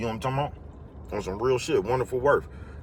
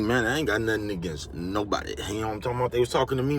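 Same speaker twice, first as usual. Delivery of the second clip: ordinary talk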